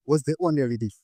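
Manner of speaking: ordinary delivery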